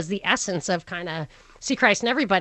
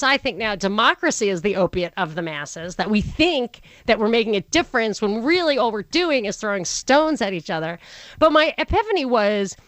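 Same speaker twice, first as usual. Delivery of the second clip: ordinary talk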